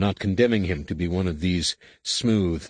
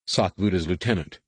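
plain reading voice

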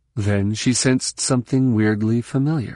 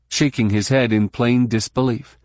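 usual speaking voice

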